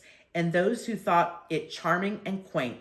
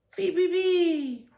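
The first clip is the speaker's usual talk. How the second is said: high chirping bird call; whistled birdcall; 13 semitones higher, 4 dB louder than usual. A narrator's reading. Narrator mimics the chickadee's high whistle. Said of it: "a chickadee whistled".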